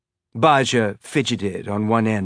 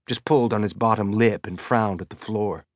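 plain reading voice

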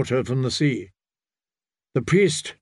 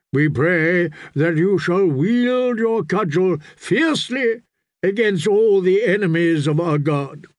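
fervent priest; 5 semitones higher than usual. Acted.

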